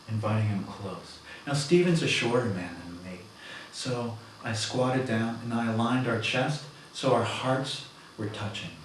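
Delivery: ordinary talk, nothing marked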